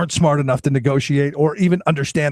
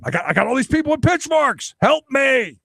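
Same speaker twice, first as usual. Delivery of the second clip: higher, hammed-up plea for help; mock panic; 6 semitones higher than usual. Negotiator cornered by people with pitchforks, mock-desperate. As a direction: plead as if a mob is closing in.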